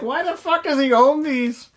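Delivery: very high put-on complaining voice; mock viewers. Reviewer imagining what viewers say, high mocking voice. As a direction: squeal it as a baffled heckler. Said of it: "You guys are like".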